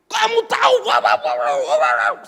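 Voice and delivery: loud, high furious shout; imitating the synod chair's furious yell; angry yelling. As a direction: scream it down at him like an enraged boss. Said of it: "teriak-teriak".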